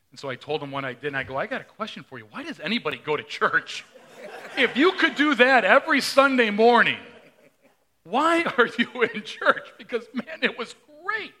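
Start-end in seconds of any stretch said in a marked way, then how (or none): 1.4-9.7 loud, high, laughing mock challenge; playful loud taunt to the Bible study. Pastor retells his challenge. 9.8-11.3 high, laughing gush of joy; real laughing delight at the memory. Real, not acted. Recalls glorious Sunday off.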